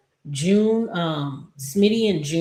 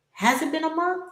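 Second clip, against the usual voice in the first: incredulous; 12 semitones higher than usual. Acted. Viewer scolding a TV character in disbelief. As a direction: pitch jumps way up in disbelief.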